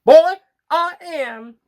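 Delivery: slow, high, loud creature drawl; smug flytrap. Acted. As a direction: stretch every word like a gloating plant. Flytrap answers the narrator.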